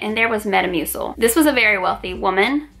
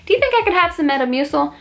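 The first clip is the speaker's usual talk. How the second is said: put-on sweet old lady; 7 semitones higher, faster than usual.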